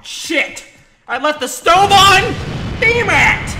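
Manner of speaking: very loud, high shrieked alarm; panicked yelling. Pretend. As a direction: scream it as if disaster has just struck. Realises stove was left on.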